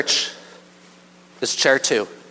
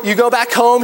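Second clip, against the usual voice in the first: ordinary talk, nothing marked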